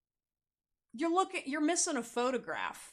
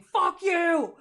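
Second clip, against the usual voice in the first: loud mock yell, pitched up; mock shout; 8 semitones higher, 10 dB louder than usual. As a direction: yell it like an angry post. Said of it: "post saying".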